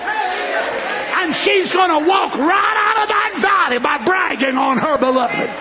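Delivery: rising, shouted sermon cadence; preacher whipping up the congregation; full-force preaching. Acted. Preacher to congregation, rousing shouted sermon.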